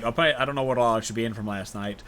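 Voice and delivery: usual speaking voice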